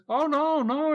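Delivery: lightly voiced boss turning him down; mock Vince refusing.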